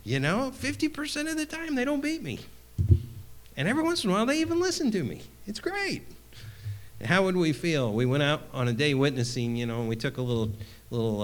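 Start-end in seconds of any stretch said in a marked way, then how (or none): 0.0-6.0 high, rushed, merry retelling of his words; much higher and very fast gleeful imitation; cheerful mock boast. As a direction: toss it off like great news. Imitating brother's cheerful attitude again.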